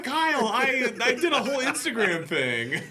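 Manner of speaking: mock-betrayed cry through laughter; laughing protest. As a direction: play her outrage while cracking up. Retelling Tia's dismay.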